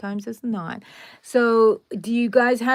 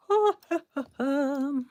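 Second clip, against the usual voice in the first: laugh; 6 semitones higher than usual. Real, not acted. Laughs at own joke.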